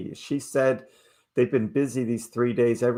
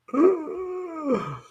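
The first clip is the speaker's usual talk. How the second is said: high, sharp surprised gasp; a quick startled intake of breath. Real, not acted. Reacts to the headline.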